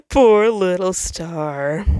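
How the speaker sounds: slow, loud, sneering pity; a strange voice gloating over the star; mocking taunt. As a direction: drag it out cruelly.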